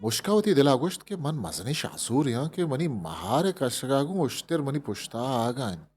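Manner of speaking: ordinary delivery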